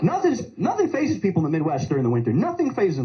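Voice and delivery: ordinary delivery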